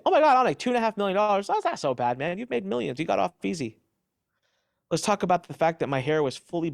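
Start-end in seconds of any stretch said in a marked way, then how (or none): 0.1-3.8 imitating a flippant onlooker; mocking dismissive quote. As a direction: parody the people who shrug it off. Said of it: "the people that look and say".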